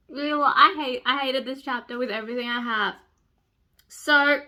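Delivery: usual speaking voice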